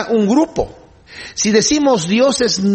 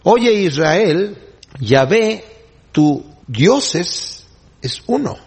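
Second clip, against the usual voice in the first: slow paraphrase reading; much slower than usual. Shown as a demonstration. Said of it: "lo que está diciendo en cierta manera es esto".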